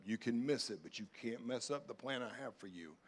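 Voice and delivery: ordinary delivery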